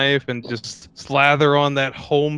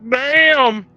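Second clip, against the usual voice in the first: high, loud mock exclamation; exclaimed damn; 11 semitones higher, 6 dB louder than usual. Friend reacting to the movie's cocky pilot, with a loud high exclamation. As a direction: blurt it out, impressed. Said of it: "just be like".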